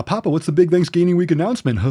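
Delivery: hammed-up pestering questioner; mocking imitation. Mocks an impatient viewer's question.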